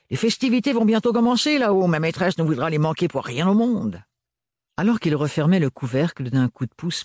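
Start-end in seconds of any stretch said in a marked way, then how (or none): 0.1-4.0 higher, brisk servant voice; a servant rushing off to the party; Renard, hurried servant. A narrator's reading. Renard to Ophélie, hurried higher voice.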